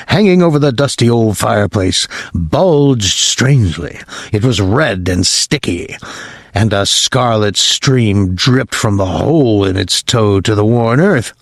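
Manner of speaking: ordinary delivery, nothing marked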